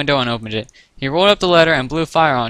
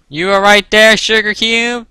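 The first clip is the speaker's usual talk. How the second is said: put-on loud, high country-accent question; Applejack's country accent; 8 semitones higher, 10 dB louder than usual. A narrator's reading. Applejack checks on her friend, country accent. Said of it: "in her unmistakably country accent".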